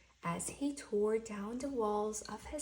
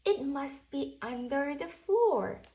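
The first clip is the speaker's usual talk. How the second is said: a hint of Owl, louder; Owl's guess; 4 semitones higher, 7 dB louder than usual. Owl searching the house, a light sure guess. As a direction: say it like you've figured it out. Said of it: "said Owl".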